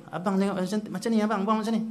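a wife instructing abang, mildly voiced; bossy wife. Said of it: "dia arah dia isteri dia". (isteri mengarah suami, nada tinggi)

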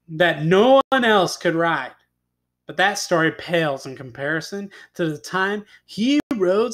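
tall-tale narration building to a high, emphatic finish; dramatic storyteller; story narration. A narrator's reading. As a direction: build up the boast for young listeners. Reads a tall tale aloud.

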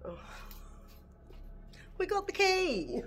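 high, thrilled exclamation; excited. Real, not acted. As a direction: celebrate finding it. Excited at finding the key.

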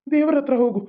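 normal talking voice

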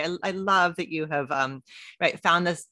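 ordinary talk, nothing marked

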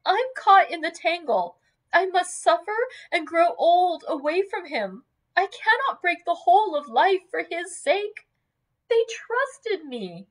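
high, anguished lament; anguished young woman's outcry, high-pitched; sobbing distress. A narrator's reading. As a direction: plead your misery, pitched high. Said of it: "She fell sobbing into the chair".

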